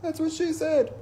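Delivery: high goofy jock voice; mock a dopey joker; dumb buddy voice. Mark joking from the far end, goofy high. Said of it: "hear Mark from the other end".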